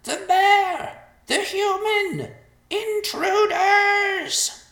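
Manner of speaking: very high, slow vulture shrieks; yelled alarm. A narrator's reading. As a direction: sound the alarm at full volume. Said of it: "he yelled".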